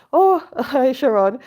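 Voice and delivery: bright, high laughing hello to a viewer; excited greeting. Real, not acted. Greeting a viewer in live chat.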